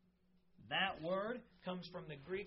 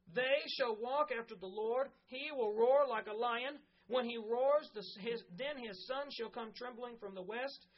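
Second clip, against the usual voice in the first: louder, solemn Bible reading; scripture reading; 3 semitones higher, 4 dB louder than usual. Acted. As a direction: declare the promise with weight. Continues reading Hosea aloud.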